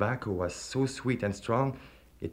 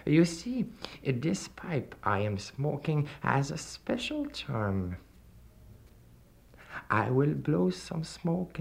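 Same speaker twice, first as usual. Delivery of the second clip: slow, higher silky devil voice; 6 semitones higher, 4 dB quieter, slower than usual. A narrator's reading. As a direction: tempt him slowly, like a sly devil. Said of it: "said the stranger".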